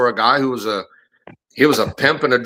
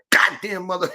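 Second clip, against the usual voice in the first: higher mock yell, kept down in volume; retold angry cursing; 7 semitones higher, 3 dB quieter than usual. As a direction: imitate muffled swearing from another room. Overheard cursing amid breaking things.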